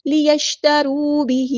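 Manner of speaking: slow, drawn-out Quranic recitation; Tajweed recitation. Shown as a demonstration. Teacher models the verse word, slow Tajweed recitation. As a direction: recite slowly, holding each long vowel.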